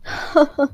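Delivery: short high scornful laugh; a quick sneering chuckle; mocking laugh. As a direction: laugh at him in contempt. Mocking laugh during the quarrel.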